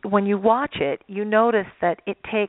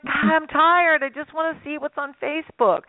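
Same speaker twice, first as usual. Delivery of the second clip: tired parent; 8 semitones higher than usual. Retold or imitated. Parent on the phone in a mock-weary voice. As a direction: play the worn-out parent reaching for the phone. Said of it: "You're like".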